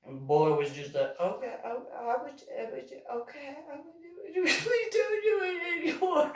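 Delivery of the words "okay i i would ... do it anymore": put-on high, much softer stammering kid; timid boy. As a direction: stammer it out, scared and small.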